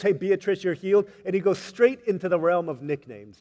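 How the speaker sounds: loud, ringing sermon build; fiery preaching. Acted. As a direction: preach it out with passion. Preaching at full force.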